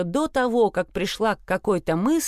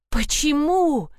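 slow, high furious cry; furious shout; 7 semitones higher, slower than usual. Acted. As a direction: hurl the question in rage. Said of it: "в ярости закричала я".

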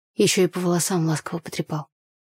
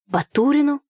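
surprised; 7 semitones higher, 5 dB louder than usual. A narrator's reading. Surprised echo question.

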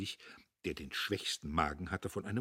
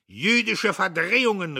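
loud, higher indignant cry; shouted accusation; 12 semitones higher, 13 dB louder than usual. Acted. Jadassohn shouts at the editor: angry cry. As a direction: fling the accusation across the table. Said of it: "rief Jadassohn".

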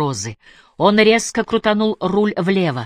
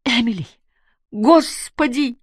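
high, drawn-out plea; tearful cry; 6 semitones higher, slower than usual. A narrator's reading. Barbara begs Emily for the girl, high tearful cry. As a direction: wail the plea high, stretching the last word. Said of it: "плакала Барбара".

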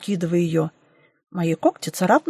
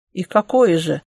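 sly, teasing question with a smirk; 4 dB louder than usual. A narrator's reading. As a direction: ask it slyly, as if amused. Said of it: "хитро усмехнулся Гончий".

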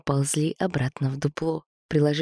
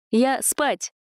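parrot voice; 9 semitones higher than usual. Acted. Parrot announces bedtime.

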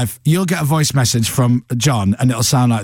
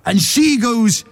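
John Bishop stage voice; 8 semitones higher, slower than usual. Retold or imitated. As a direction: belt it out slowly like a Scouse comic on stage. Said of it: "if you've ever seen John Bishop on stage".

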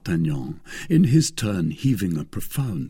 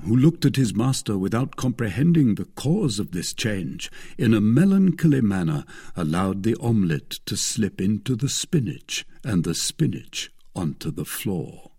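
usual speaking voice

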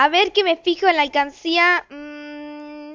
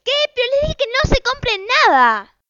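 scolding mom; 6 semitones higher than usual. Acted. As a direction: tell the kids off, exasperated. Mother scolds kids over purchase.

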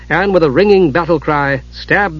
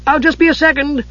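kender voice; 12 semitones higher than usual. Acted. Tass voiced.

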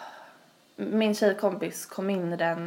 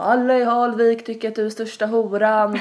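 a hint of a sneering bully girl, louder; taunting bully girl; 3 semitones higher, 7 dB louder than usual. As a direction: jeer it as a mean teen. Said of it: "Och de bara".

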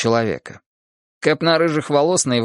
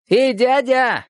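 higher shout across the street, Эй, дядя!; 11 semitones higher, much slower than usual. A narrator's reading. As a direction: call out sharply to a stranger. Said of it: "крикнул и Виталик". (крик)

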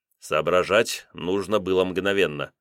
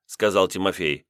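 ordinary delivery, nothing marked

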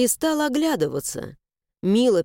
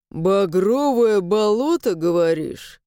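thoughtful; slower than usual. Acted. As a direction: ponder it aloud. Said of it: "задумчиво произнёс он".

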